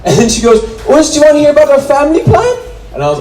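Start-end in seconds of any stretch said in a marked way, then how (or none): 0.9-2.6 mock German accent, high and fast, pitched way up; hammed-up German sales pitch with a rising end; German saleswoman. German saleswoman quoted on stage.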